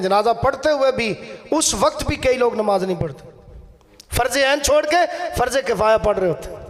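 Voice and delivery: normal talking voice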